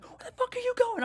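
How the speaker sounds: put-on the angry boyfriend chasing him to the car, yelling; mock angry yell. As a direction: play the dude screaming after him. Dude bursts out exit yelling.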